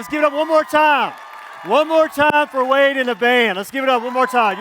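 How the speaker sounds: loud, high hype call; rally the crowd to cheer the band; calling for applause. Real, not acted. Calling for applause after song.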